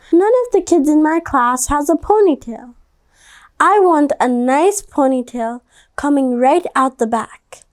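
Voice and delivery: a hint of a child asking mom; child Stephanie. A narrator's reading. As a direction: read it as a pleading kid. Child voiced in read story.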